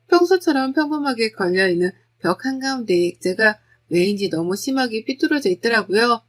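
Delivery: ordinary delivery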